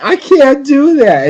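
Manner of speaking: high, loud, drawn-out mock complaint; retold protest. Korra protesting to Tenzin about meditation, mock whiny complaint. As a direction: imitate a frustrated pupil, slow and high.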